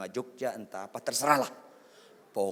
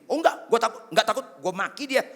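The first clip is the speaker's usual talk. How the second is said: high, loud blustering denial; mock defiant husband; 12 semitones higher, 9 dB louder, faster than usual. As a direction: protest too much, loudly. Acts husband denying fear of wife.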